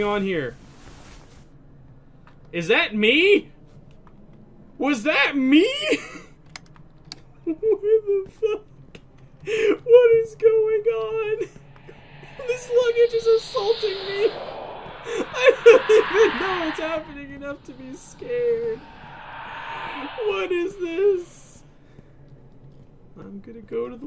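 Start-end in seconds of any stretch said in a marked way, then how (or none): 2.5-5.7 louder, higher startled questions. Real, not acted. Startled by jump scare. 5.7-7.2 high breathy nervous laugh. Real, not acted. Nervous laugh after scare. 7.4-21.6 high, slow, laughing cries; panicked laughter at the chaos. Real, not acted.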